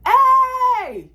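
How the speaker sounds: high yell after a bus; shouting. Retold or imitated. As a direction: call out hey to stop them. Chasing the leaving bus.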